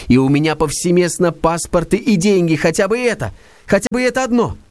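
high, excitable zealot voice; Verkhovensky's fevered rant; feverish schemer. Acted. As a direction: rave on like a fanatic.

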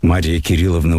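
plain reading voice

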